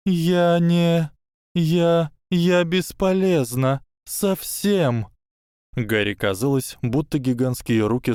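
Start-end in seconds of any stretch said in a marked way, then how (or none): 0.1-5.1 high, tearful broken voice; sobbing. A narrator's reading. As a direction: weep the words out brokenly. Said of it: "Всхлипы Белатрисы стали сильнее".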